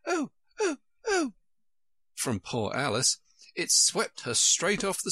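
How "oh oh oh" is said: series of short, very high squeals; tiny high-pitched "oh" shrieks; little shrieks. A narrator's reading. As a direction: squeak each cry separately, very high. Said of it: "a series of little shrieks".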